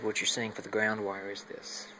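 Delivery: ordinary talk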